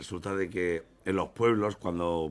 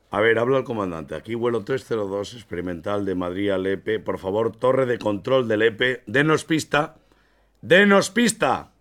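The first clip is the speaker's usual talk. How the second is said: building demand; 2 semitones higher, 8 dB louder than usual. Acted. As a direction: push louder each time. Captain demanding runway, rising.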